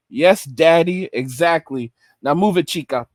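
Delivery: low pimp voice, satisfied; Slickback. Acted. Pimp sending the girl off, low controlling voice. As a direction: dismiss her low and cool, like a pimp. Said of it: "a pimp named Slickback".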